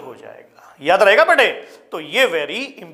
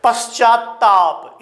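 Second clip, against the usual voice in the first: slow dictation; 3 semitones higher, much slower than usual. Shown as a demonstration. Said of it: "ये पूरा तय".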